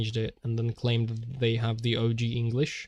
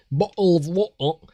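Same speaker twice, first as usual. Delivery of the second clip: loud, higher mock British accent with dropped t's in "Bottle of water"; mock English accent; 6 semitones higher, 6 dB louder than usual. Streamer teasing the English, mock British accent on "Bottle of water". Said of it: "make fun of the English for saying".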